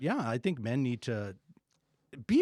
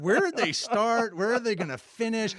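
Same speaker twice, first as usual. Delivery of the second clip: mock confused listener; 8 semitones higher, 6 dB louder than usual. Mimicking confused note-takers.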